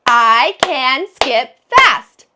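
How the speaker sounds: slow loud clapped sentence; clapping the words. Shown as a demonstration. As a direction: clap out each word slowly and loudly. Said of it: "Let's clap the words".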